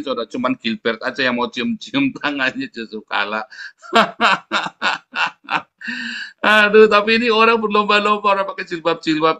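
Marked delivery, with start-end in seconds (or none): 3.9-5.6 laugh. Real, not acted. Laughing at own joke.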